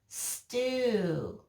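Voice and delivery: "Stew" with its end vowel held long; lingering "Stew" that falls at the end; isolated open syllable. Shown as a demonstration. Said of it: "hear the vowel sound at the end".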